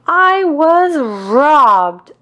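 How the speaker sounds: very slow, high, loud wail; howling. A narrator's reading. Sally telling Linus what happened, howling in outrage. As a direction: drag it out in a howl. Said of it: "howls Sally".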